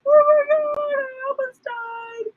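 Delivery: high, tearful wail, slowing at the end; high retold sobbing; bawling. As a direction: sob it out in relief. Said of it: "then I started bawling".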